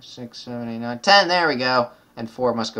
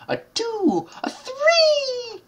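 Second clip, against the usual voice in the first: counting, climbing much higher into a laughing owl voice; Tootsie Pop owl; 4 dB louder, much slower than usual. Retold or imitated. Gamer imitating the Tootsie Pop owl, rising and laughing. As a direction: do the owl from the old lollipop ad. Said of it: "Tootsie Roll Pop".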